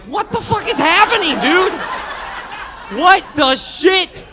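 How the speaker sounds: high, loud comic outburst; exasperated yelling. Retold or imitated. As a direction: yell it in hammed-up disbelief. Acted outburst at the revelation.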